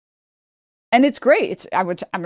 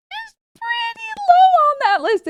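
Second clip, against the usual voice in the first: much higher and louder, held out; sarcastic squeak; 4 dB louder than usual. Real, not acted. Mocking the guest's claim with a high squeak.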